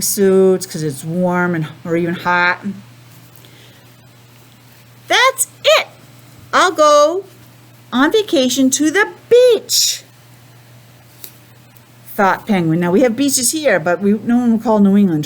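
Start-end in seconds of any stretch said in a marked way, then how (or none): 5.1-10.0 high, bright excited exclamation; penguin's sudden excitement; excited realization. Acted. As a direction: burst out with the idea. Penguin's excited idea in story.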